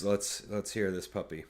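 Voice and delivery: usual speaking voice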